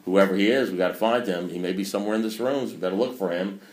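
ordinary talk, nothing marked

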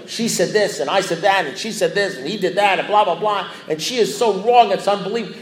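high-pitched, piled-up litany of grievances; detailed rant. Retold or imitated. Venting to his sponsor about his wife, high-pitched rant. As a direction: re-enact an indignant blow-by-blow complaint. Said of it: "I'm telling you in detail".

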